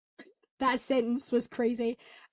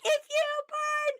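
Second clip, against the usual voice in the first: high, slow, cracked Katniss line; croaky Katniss; slower than usual. Retold or imitated. Hoarse streamer mocks her own cracked voice as Katniss. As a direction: squeak it out like a broken Katniss. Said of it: "I sound like Katniss".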